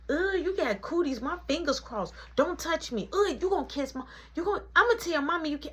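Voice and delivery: put-on high little-kid tattling voice; high, mock-disgusted child; grossed-out kid. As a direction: imitate a child squealing about cooties. Voices little brother disgusted by kiss.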